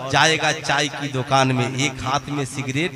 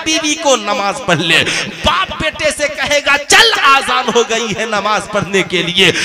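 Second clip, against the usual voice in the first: high, loud preaching, shouted out; fiery preaching; 9 semitones higher, 8 dB louder than usual. Acted. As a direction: thunder the sermon at the faithful. Preaching at full force.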